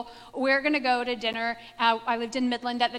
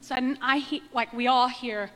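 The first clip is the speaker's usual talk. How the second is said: normal talking voice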